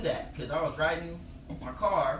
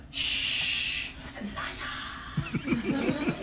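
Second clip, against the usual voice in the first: put-on hissed hush in African accent; long shush, then whisper it tensely; stage whisper; 10 semitones higher, much slower than usual. African hunter warning of a lion, hushed stage whisper.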